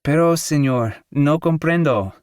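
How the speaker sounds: a giggling shopgirl who doesn't understand; Mexican girl. A narrator's reading. Mexican girls answer in Spanish.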